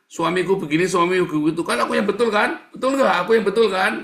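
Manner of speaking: high, loud mock complaint; seeking self-justification. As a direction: press insistently for agreement as the aggrieved wife. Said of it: "dia lagi cari pembenaran". (merengek cari pembenaran)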